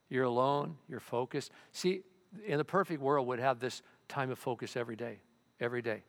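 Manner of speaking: ordinary talk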